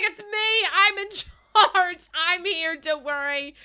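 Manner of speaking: high, loud mock announcement; imitating Rich. Mimicking Rich rushing to take charge, high loud impression. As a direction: play someone eagerly taking charge, high and loud. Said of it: "Rich is like".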